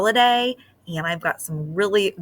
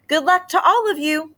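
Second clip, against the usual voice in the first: higher, brighter well-wishing voice; cheery mayor; 10 semitones higher, 3 dB louder than usual. Acted. Mayor wishing the contestants luck, cheerful. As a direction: wish everyone luck from the podium. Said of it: "said the mayor".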